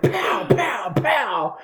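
high, playful "Pow, pow, pow!" through laughter; cartoon bang noises, giggling; mock pow sounds. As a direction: imitate fireworks with your voice. Imitating sparkle fireworks going off.

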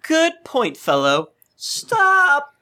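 high, hammed-up sudden reversal; a jokey high-pitched John calling it off; mock John. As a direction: squeak it out, mock-polite then cut off. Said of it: "And then John's like".

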